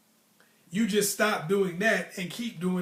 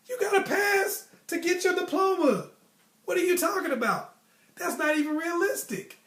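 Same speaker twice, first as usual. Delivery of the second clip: high, louder incredulous rant; 12 semitones higher, 5 dB louder than usual. Real, not acted. Reacting to a classmate dropping out, high incredulous scoff. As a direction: scoff at how absurd the plan is.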